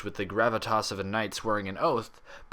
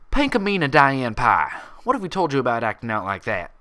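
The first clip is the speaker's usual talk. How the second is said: scolding; 3 semitones higher, 7 dB louder than usual. A narrator's reading. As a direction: scold like a mother to a naughty child. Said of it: "scolding her like a disobedient filly".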